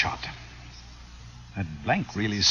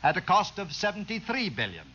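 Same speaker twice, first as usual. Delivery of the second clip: a lightly raised speechmaking voice; formal speech; 8 semitones higher than usual. Acted. Outgoing Kip giving his farewell speech to the crowd, formal speech. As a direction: address the assembled crowd with pomp.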